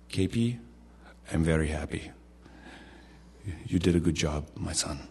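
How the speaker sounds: put-on Indian-accented voice of a pleased Lord; soft, measured Indian accent, dropping low; gentle fatherly praise in an Indian accent; K P Yohannan. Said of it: "in his little Indian accent".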